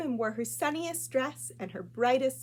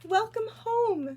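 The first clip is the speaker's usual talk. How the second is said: high, slow, loud welcome; welcoming mother; 11 semitones higher, 5 dB louder, slower than usual. Acted. Mother greeting her daughter home, slow, high and loving.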